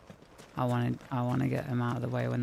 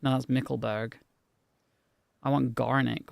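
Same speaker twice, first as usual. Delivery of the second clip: ordinary delivery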